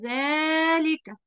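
ذلك stretched very slowly, long aa after the dhal; drawn-out demo word. Shown as a demonstration. Teacher showing students the spoken alif in ذلك, very slow. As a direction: sound out ذلك as dhaalika. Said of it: "فهنا أنا نطقتها إيه؟".

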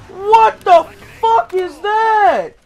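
very high, loud, stretched-out yell; alarmed shout. Real, not acted. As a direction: scream it slowly in panicked disbelief. Repeats alarmed scream at the monster.

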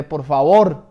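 loud cry for help; acted shout for rescue; shouted plea. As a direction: yell desperately to anyone around. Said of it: "Entonces grité".

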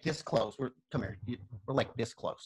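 low, soft words up close; hushed close talk. Retold or imitated. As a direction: speak low, nose to nose. Umpire face to face.

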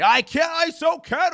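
high, whiny put-on whimper; mock a fragile man who can't cope. Host mocking critic, high whiny whimper. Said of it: "The gray canary".

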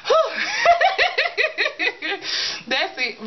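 ordinary talk

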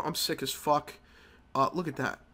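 ordinary delivery

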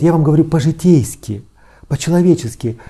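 ordinary delivery

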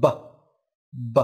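"B" with the vocal cords vibrating; voiced b sound. Shown as a demonstration. Teacher shows the final sound of describe, voiced b. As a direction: say "B" as a short voiced stop. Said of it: "cần phải rung dây thanh quản".

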